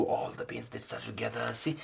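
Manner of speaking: ordinary delivery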